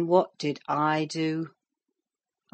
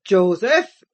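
loud, higher call with a rising end; shouted summons; 4 semitones higher, 8 dB louder than usual. A narrator's reading. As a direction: shout for a servant down in the cellar. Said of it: "he shouted again".

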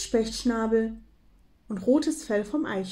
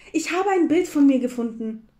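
excited call, higher and louder; 5 semitones higher, 7 dB louder than usual. A narrator's reading. Hare calls up to the squirrel, calling out. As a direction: shout the happy news upward. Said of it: "rief der Hase nach oben".

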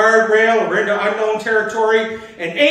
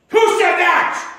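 sharp, much higher barked challenge; barked demand; 9 semitones higher, 3 dB louder than usual. Retold or imitated. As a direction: bark it out like an angry leader at a crowd. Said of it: "Khrushchev barked".

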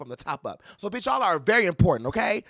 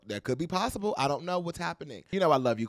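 ordinary delivery

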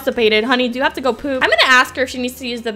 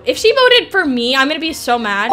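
ordinary talk, nothing marked

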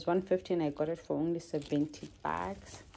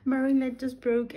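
higher, louder exasperated cry; exasperated outburst; 7 semitones higher, 7 dB louder than usual. Real, not acted. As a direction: blurt it out in disbelief. Repeats complaint louder after laugh.

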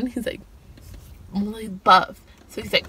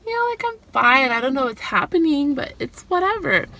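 higher, nervous brush-off; flustered denial; 6 semitones higher than usual. Retold or imitated. Insisting to Jake she's fine while her heart races, high and flustered. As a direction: squeak out that you're fine.

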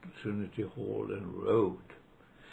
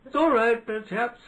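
high, clipped mock-upper-class chap; hammed-up posh voice, pitched high; mock posh rower; 10 semitones higher, 7 dB louder than usual. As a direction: play the breezy Cambridge chap apologising to the crew. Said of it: "he came back".

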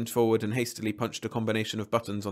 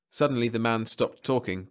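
plain reading voice

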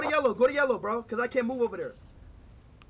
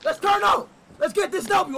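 loud, raised-pitch team hype; hype shout; 3 semitones higher, 6 dB louder than usual. Real, not acted. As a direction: fire up the squad. Hyping the team at start.

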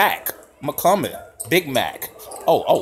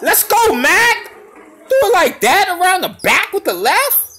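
excited cheer. Real, not acted. Cheering Mac on after a behind-the-back move, excited. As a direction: cheer him on like courtside.

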